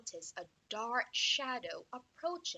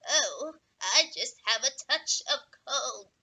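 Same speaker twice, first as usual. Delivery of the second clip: squeaky voice; 6 dB louder than usual. Acted. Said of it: "squeaked the wolf".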